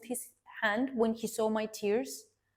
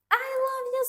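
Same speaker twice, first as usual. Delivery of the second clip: much higher-pitched, put-on sweet declaration; mock lovey-dovey; 13 semitones higher, faster than usual. Narcissist declaring love before the sudden switch, mock lovey-dovey. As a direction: imitate a love-bomber in a high voice.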